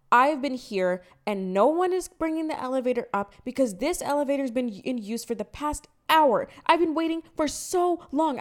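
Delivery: hammed-up irate neighbor rant; imitating angry neighbor. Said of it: "She starts getting mad 'cause she's like".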